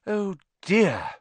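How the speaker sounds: high, rueful sigh of words; sorry and dismayed; sad. Acted. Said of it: "said Hermione sadly".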